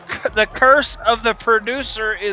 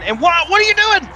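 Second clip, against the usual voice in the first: exasperated; 9 semitones higher than usual. Pretend. Scolding the onside kick.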